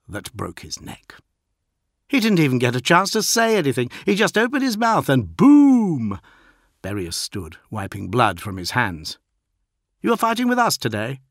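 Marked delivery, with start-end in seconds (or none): 2.1-6.2 fast, raised and loud bragging; gleeful young warrior. A narrator's reading. Berias voiced by narrator. 10.0-11.2 quick, raised curious recruit; young warrior voice. A narrator's reading. Berias voiced by narrator.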